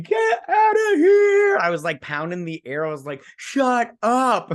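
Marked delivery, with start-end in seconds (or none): 0.0-1.6 very high, loud drawn-out squeal; ecstatic disbelief. Retold or imitated. Fan seeing her face on screen, ecstatic shriek. Replaying own excited disbelief. 3.4-4.5 high, loud hammed-up exclamation; over-the-top disbelief. Fan pounding the air at the reveal, over-the-top disbelief. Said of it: "I was acting so stupid".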